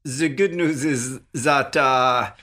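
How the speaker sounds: hammed-up, drawn-out German accent. Podcaster defends his accents to his cohost, exaggerated German accent. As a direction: draw it out in a bad German accent. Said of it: "I'll never do German accent again".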